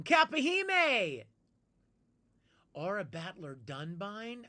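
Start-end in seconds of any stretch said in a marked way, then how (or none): 0.0-1.2 high, loud, slow drawn-out name call; excited shout-out. Real, not acted. Greeting a fan in chat, drawn-out excited call. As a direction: sing the name out in welcome.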